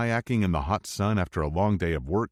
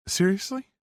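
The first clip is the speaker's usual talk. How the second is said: excited. Acted. Emerson excited at invite.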